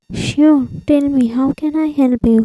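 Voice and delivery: usual speaking voice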